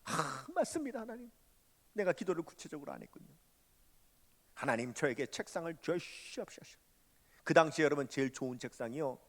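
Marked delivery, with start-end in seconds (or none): 0.0-6.8 sheepish prayer. Retold or imitated. Humbled preacher accepting God's rebuke.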